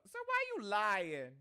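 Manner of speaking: high, drawn-out mock urban accent; Adrian's urban accent. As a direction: imitate a questioning student with a slow urban accent. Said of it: "Yung accent mo kapag ka".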